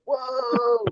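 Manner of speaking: high, drawn-out retold "whoa"; amazed whoa. Teammates recalling a rider's crash into the barrier, amazed. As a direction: marvel in a high, held-back voice.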